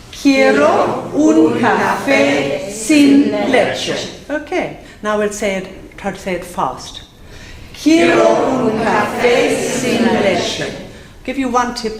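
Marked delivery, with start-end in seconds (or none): 0.1-4.3 very slow, loud-edged teacher's model, each word separated. Shown as a demonstration. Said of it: "we say it word by word". 7.7-10.6 slow, linked-up sentence for learners; all in a row. Shown as a demonstration. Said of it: "say it all in a row".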